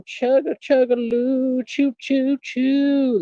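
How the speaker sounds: high, drawn-out mock train chugging; train noise. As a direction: chug like a toy train, way up high. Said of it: "The train is a running".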